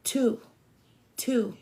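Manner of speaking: lightly voiced insistent spirit; a hint of an urgent inner voice repeating. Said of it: "Spirit kept saying".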